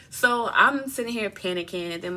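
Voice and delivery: usual speaking voice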